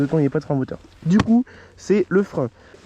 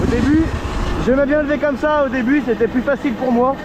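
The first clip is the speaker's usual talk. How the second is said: raised outdoor voice, louder and higher; projecting over the wind; shouting over wind; 4 semitones higher, 3 dB louder than usual. Real, not acted. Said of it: "je sais pas si vous m'entendez bien avec le vent".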